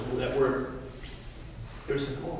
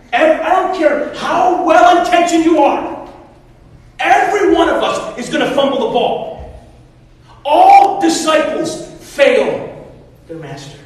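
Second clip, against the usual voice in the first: loud, raised preaching voice; emphatic preaching; 9 semitones higher, 12 dB louder, slower than usual. Real, not acted. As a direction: drive it home to the congregation. Preaches to the congregation.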